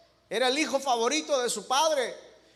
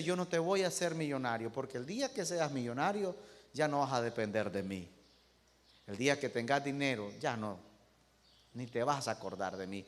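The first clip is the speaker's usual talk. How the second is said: soft, gentle God; 10 semitones lower, 9 dB quieter, faster than usual. Acted. God answers the greedy man.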